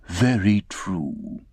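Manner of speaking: slow, grave, emphatic. Acted. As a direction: weigh each word gravely. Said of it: "said gravely and emphatically".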